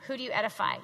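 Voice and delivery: usual speaking voice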